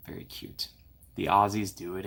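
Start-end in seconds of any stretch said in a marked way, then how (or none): 0.1-0.7 whispered echo of "Very cute"; fond whisper. Real, not acted. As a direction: breathe it in a whisper. Praising the show Bluey.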